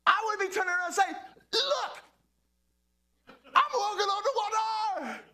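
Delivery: high, loud, thrilled shouting; preacher imagining walking on water, thrilled shout; excited preaching. Real, not acted. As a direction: shout it up with wonder. Preaching excitedly at full force.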